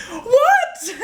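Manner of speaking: very high, incredulous what; baffled outburst. Acted. Fed-up acting student reacting to the exercise, incredulous. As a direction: squeal it in disbelief.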